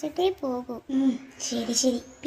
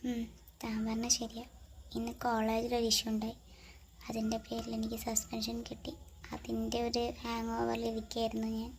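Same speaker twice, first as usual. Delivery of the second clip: much softer, hushed voice; 2 semitones lower, 11 dB quieter than usual. Acted. A girl wondering about a late call from Ram, hushed and subdued.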